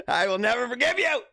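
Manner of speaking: high, hammed-up grudge; mock outrage. Mock accusation addressed to parents.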